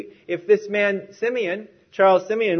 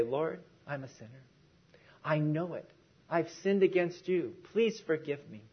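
soft, halting prayer of repentance; humble confession; 10 dB quieter than usual. Acted. Preacher modelling a sinner's prayer to the Lord, soft confession. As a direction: confess it quietly, pausing between phrases.

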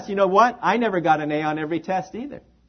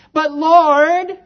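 hammed-up whine, high on Lord then sinking; whiny; 10 semitones higher, 8 dB louder than usual. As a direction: play a petulant, whining believer. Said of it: "Kind of whiny".

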